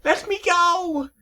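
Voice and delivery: very high, loud scream, slowing; panicked terrified screech; desperate cry while caught; screaming in pain and fear. Acted. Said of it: "she screamed in both pain and fear".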